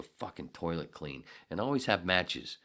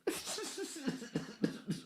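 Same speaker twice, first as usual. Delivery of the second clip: high laugh; 4 dB quieter than usual. Real, not acted. Laughs at friend's verdict.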